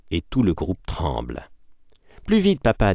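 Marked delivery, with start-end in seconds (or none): 2.3-2.9 put-on much higher, young girl's urgent plea; urging daughter. A narrator's reading. Daughter urging her father to hurry, pleading. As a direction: hurry him along like a little sister. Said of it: "dit sœur Ernestine".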